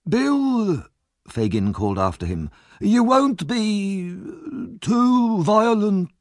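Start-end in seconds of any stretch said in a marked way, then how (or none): 0.1-0.9 high call after him. A narrator's reading. 2.8-6.1 very high, very slow villain's voice; mock-caution, really urging him on; sly false warning. A narrator's reading.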